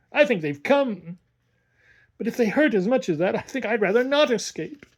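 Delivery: put-on the boy dreading the pain; rueful. A narrator's reading. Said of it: "he added ruefully".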